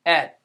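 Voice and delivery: "et" with a separately released t; short "et", crisp detached t; sounded out. Shown as a demonstration. Teacher gives second syllable, sounded out. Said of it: "Second syllable".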